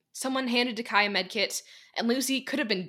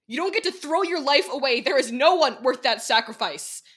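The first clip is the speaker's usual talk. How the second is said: loud, high, desperate yell; screaming; 5 semitones higher, 7 dB louder than usual. Acted. Dekai yelling at Lucy that no one is worth it, loud and raw. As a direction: keep shouting at her, pleading. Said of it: "he screamed".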